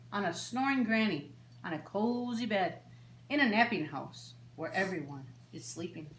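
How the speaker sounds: lilting singsong storybook read; reading to children. A narrator's reading. Librarian reading a bedtime book to children, gently lilting. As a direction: read it like story time for little ones. Reads picture book aloud.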